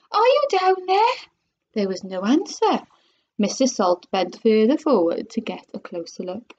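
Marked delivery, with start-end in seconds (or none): 0.1-1.2 high-pitched calling voice. A narrator's reading. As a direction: call the question down a hole, pitched high. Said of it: "shouted Missus Salt".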